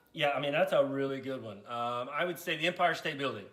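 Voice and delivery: a shy low-voiced puppet fumbling through his story; deep puppet voice Matt.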